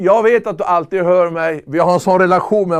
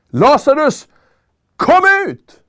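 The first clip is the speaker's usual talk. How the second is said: "Lazarus, kom ut!" shouted much higher, ringing out; commanding shout; 6 semitones higher, 4 dB louder than usual. Acted. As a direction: call him out of the grave with full authority. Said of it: "nu säger han det med hög röst". (högt befallande rop, mycket ljusare)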